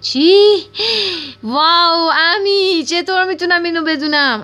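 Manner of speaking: high, loud, wide-eyed wonder; thrilled grandmother discovering her new mind; amazed excitement. Acted. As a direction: gasp it out as if the whole world just opened up.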